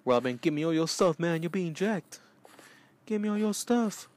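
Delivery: high mock robber demands; mugger voice. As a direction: rob Robin in a hammed-up high voice. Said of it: "This is Batman".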